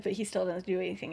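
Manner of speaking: plain reading voice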